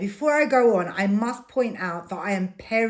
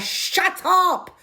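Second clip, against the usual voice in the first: screaming back; 10 semitones higher than usual. Retold or imitated. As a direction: yell it back at her. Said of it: "I just scream back at her".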